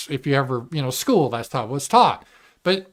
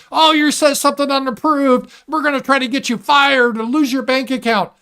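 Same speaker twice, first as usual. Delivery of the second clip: high, loud sneering threat; mock scold; 10 semitones higher, 5 dB louder than usual. Streamer mocking journalists, high and loud. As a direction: play a shrill caricature of a commissar.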